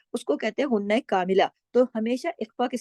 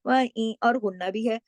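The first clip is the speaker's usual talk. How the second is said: ordinary delivery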